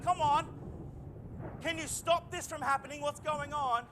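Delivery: high, pleading cry for help; pleading with Jesus. Retold or imitated. Praying person begs Jesus to calm the storm, high pleading. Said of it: "and you're like".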